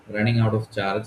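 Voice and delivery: ordinary talk, nothing marked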